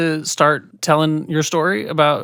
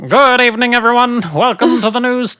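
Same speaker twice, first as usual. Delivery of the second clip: high, loud transatlantic newsreader voice; old-timey TV news announcer; 1950s newscaster; 9 semitones higher, 7 dB louder than usual. Pretend. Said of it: "like it's the local news in the fifties".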